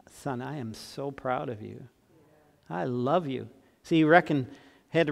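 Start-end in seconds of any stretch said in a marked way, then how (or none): 0.1-3.5 tender father. Retold or imitated. Dad's tearful words at wedding.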